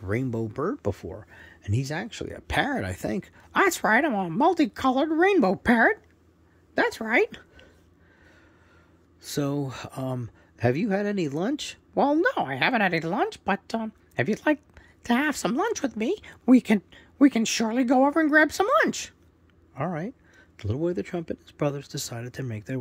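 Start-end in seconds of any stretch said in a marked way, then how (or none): 3.5-7.4 high, loud squawky parrot; proud parrot introducing himself, high and loud. Acted. Parrot character answers the boys. 11.9-19.1 high, loud squawky parrot; proud parrot introducing himself, high and loud. Acted. Parrot character talks.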